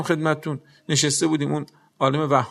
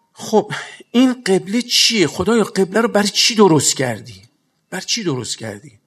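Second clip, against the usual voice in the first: rhetorical plea; 4 semitones higher, 4 dB louder than usual. Acted. Rhetorical question to God.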